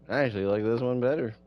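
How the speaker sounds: usual speaking voice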